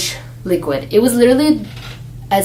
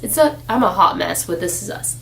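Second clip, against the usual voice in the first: ordinary talk, nothing marked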